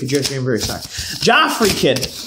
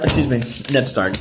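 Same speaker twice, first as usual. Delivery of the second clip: ordinary delivery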